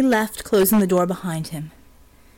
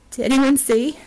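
higher, anxious question with rising panic; choked-out panic; 5 semitones higher, 4 dB louder than usual. A narrator's reading. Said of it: "she choked out".

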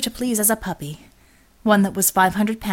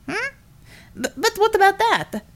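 put-on childlike giant; 11 semitones higher than usual. A narrator's reading. Chug asks innocently.

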